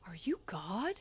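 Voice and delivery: whispery, naive question; mildly acted reverent whisper; hushed awe. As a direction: ask it under your breath, lightly acted. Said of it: "and he goes".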